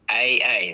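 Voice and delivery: "A A" with a glottal catch between the vowels; glottal stop demo. Shown as a demonstration. Teacher demonstrating a glottal stop to a learner. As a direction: close the throat between the two A sounds. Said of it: "if I wanted to say".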